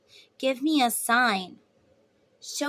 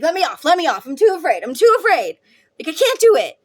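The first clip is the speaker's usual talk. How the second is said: higher, louder frantic begging; mock panic on the ride, much louder; panicked plea; 5 semitones higher, 10 dB louder, faster than usual. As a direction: beg to get off like you're terrified.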